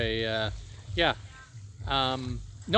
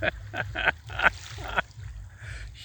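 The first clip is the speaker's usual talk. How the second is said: long, soft breathy laughter; fond laughter; 4 semitones higher, 5 dB quieter than usual. Real, not acted. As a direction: laugh fondly, softly. Laughs fondly at memory.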